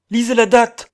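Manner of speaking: very high, loud shout; urgent cry. A narrator's reading. Man showing calendar to companions, high urgent shout. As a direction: shout it as a frantic order. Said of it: "cria-t-il".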